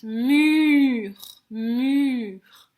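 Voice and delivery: "mur" drawn out to expose its sounds; slow, lengthened "muuur" for beginning spellers; sounding out the word. Shown as a demonstration. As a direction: stretch the vowel in "mur" so each sound is heard. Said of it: "Quel son on entend?".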